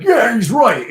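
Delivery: high, loud mocking shout of agreement; mock yell. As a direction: bark it like a hothead. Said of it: "don't be like".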